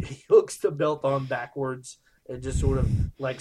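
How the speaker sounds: normal talking voice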